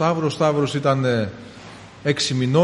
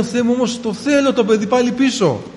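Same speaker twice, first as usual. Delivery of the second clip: pleading cry; 8 semitones higher, 4 dB louder than usual. Retold or imitated. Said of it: "φώναζα με πίστη στο Θεό".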